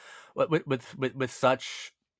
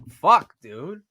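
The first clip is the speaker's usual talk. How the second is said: louder, higher pained curse; anguished outburst; 6 semitones higher, 8 dB louder than usual. Real, not acted. Vlogger lamenting a boy's pointless death, anguished. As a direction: let the sadness burst out. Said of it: "That's so sad".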